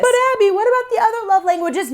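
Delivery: high, fast whiny objection; whiny viewer voice. Pretend. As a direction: squeak it out like a pushy fan. Imagined viewer objection, put-on voice.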